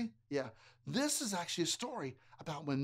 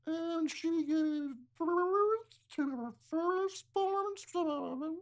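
high, very slow mermaid voice; 10 semitones higher, much slower than usual. Acted. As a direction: sing it sweetly like a mermaid. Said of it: "A mermaid voice".

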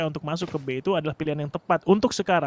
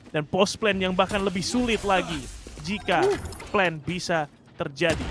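ordinary talk

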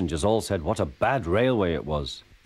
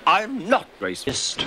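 slow, higher indignant denial; choked protest; 10 semitones higher, slower than usual. Acted. Thomas denies Percy's accusation, choked and slow. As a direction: protest slowly as if half choked. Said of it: "choked Thomas".

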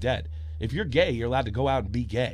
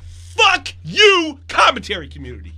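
much higher and louder, yelling at the commentary community; furious outburst; 12 semitones higher, 5 dB louder than usual. Real, not acted.

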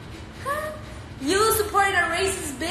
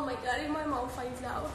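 hushed worry; 12 dB quieter than usual. Real, not acted. Worried aside, much softer.

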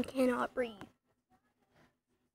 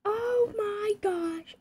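slow, much higher drawn-out gasp; mortified; 8 semitones higher, much slower than usual. Real, not acted. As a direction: stretch it out in horror. Mortified by awkward text.

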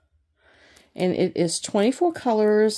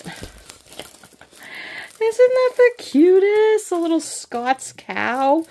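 much higher, louder, slow cutesy squeal; gushing squeal; 12 semitones higher, 7 dB louder, slower than usual. Real, not acted. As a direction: coo over the cutest thing you've seen. Gushing over cute cow picture.

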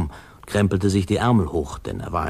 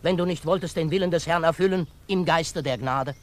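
preachy demand; 10 semitones higher than usual. Acted. Reverend demanding the judge explain himself, high and preachy. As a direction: demand an answer from the pulpit.

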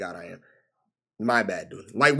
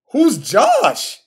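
higher, disbelieving Who's Josh with the fall on Josh; repeated in disbelief, pitched up; incredulous; 8 semitones higher, 3 dB louder than usual. Real, not acted. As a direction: ask it again, stunned and higher.

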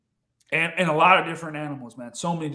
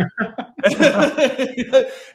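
burst of laughter; 5 semitones higher, 4 dB louder than usual. Real, not acted. Laughs at being hushed.

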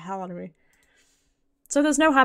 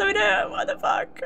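much higher, slow, rising what the fuck; stunned disbelief; 13 semitones higher than usual. Real, not acted. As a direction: drag it out in disbelief. Shocked by game ending.

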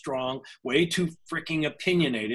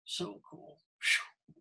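breathy, near tears, fighting them back; holding back real tears, barely voiced; about to cry; 6 dB quieter, slower than usual. Real, not acted. Tearing up while telling how his love life turned around, breathy whispers. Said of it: "I'm gonna cry".

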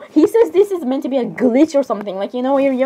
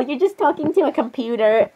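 normal talking voice

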